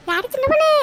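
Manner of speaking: high, loud call across the yard. Acted. Friend at the door shouts for Chinna Ponnu, loud call. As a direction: shout it so the house hears. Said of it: "என்னடி இம்புட்டு கத்து கத்துற?".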